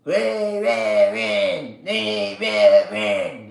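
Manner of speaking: slow drawn-out mock of a politician; mocking quote. Mocking a politician's rally line, slow and sneering. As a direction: drag it out to mock him. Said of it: "Held his little hand and said".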